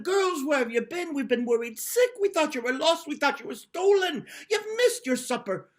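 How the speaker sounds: high, loud frantic parents; worried yelling. Acted. Worried parents yelling at daughters come home late. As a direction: shout at the late girls in panic. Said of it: "they started shouting and yelling".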